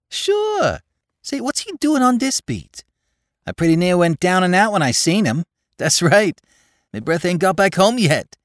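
put-on excited young New York tough; Bowery boy Spike. A narrator's reading. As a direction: rattle it off like a breathless Bowery lad. Spike voiced by narrator.

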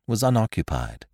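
ordinary delivery

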